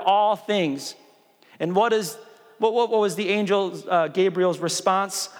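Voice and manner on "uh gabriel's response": ordinary delivery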